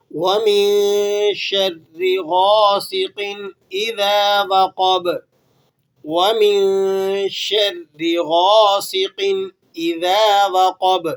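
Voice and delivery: slow chanted tilawat; Quran recitation. Shown as a demonstration. Teacher recites the next verse. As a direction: chant it slowly with tajweed. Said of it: "next آیت دیکھیے".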